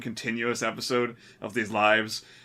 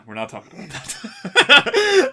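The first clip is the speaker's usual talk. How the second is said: laughing; 12 semitones higher, 9 dB louder, slower than usual. Real, not acted. Jokingly repeating a refusal.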